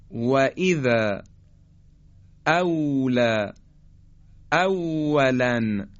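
very slow, drawn-out «والذین» with W from rounded lips; measured Arabic demo of W, each word held long. Shown as a demonstration. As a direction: purse the lips for every «و» in «اولا», no teeth.

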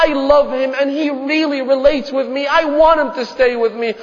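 impassioned acted child's plea; desperate pleading daughter. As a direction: implore father at full pulpit intensity. Daughter begging for infant brother.

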